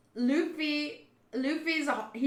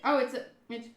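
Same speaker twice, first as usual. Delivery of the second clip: ordinary delivery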